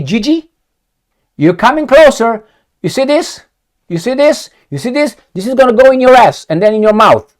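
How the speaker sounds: high, loud threatening warning; mock threat. Threatening a man on the street in a mock rage. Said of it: "And I say".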